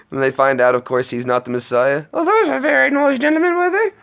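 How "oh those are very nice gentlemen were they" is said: very high falsetto squawk; Python mother. Retold or imitated. Acting out the Brian manger scene, high falsetto. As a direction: play the suspicious mum at the door.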